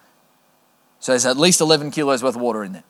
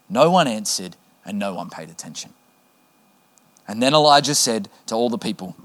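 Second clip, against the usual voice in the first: ordinary talk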